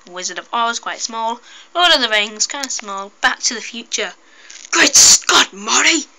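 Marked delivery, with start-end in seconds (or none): 4.7-6.1 high excited mad scientist; Doc Brown voice. Pretend. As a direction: play Doc shouting in shock. Back to the Future figures talking.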